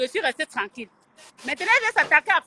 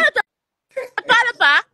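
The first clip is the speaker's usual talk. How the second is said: angry shout; 8 semitones higher, 5 dB louder, slower than usual. Acted. Rant at rival blogger.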